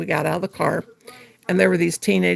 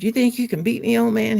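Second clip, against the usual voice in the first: higher-pitched mock taunt; 6 semitones higher than usual. Boy challenging her dad in the parking lot, taunting. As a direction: imitate a boy goading with a challenge. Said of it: "like, taunting my dad".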